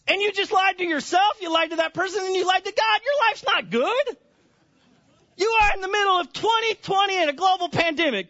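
high, impassioned rebuke; preaching at full force. Real, not acted.

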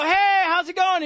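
high, loud, drawn-out hello; cheery church greeting. Acted.

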